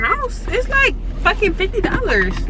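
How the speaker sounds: fake-shocked high exaggeration; joking fake complaint; mock outrage. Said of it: "No, I'm just playing".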